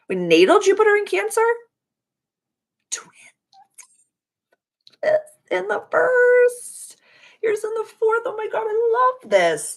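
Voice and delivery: very high, bright-voiced delight; high excited gushing; excited squeal. Real, not acted. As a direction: squeal with sudden joy. Excited astrology reaction to chart.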